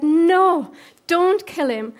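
forceful, high-pitched objection; urgent refusal. Acted. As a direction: act out stopping someone from killing. Acting David stopping the killing.

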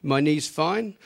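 usual speaking voice

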